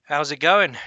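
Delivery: loud mock Australian greeting; a hammed-up Aussie hello; Australian-sounding. Shown as a demonstration. GM tests the cockney, it comes out Australian. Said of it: "It sounds Australian".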